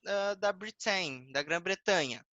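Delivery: ordinary delivery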